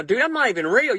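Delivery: normal talking voice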